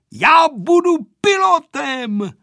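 high, loud, drawn-out call; excited declaration. A narrator's reading. Vojta telling the pilot his dream, excited loud declaration. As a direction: proclaim it slowly at full voice. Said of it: "ozval se znovu".

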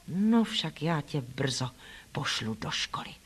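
low, slow, barely voiced grumble; aunt muttering to herself, low and soft; muttered to herself. A narrator's reading. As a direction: mutter under the breath while turning away. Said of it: "zabručela si paní Reedová".

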